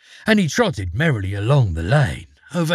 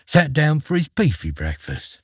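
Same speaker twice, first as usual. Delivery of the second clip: plain reading voice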